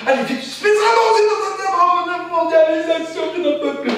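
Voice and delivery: high-pitched frantic outburst; frantic panic. Acted. Stressed worker breaking down in high panic. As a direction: squeal it in rising panic.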